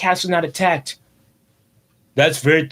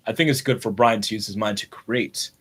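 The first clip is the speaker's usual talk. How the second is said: ordinary talk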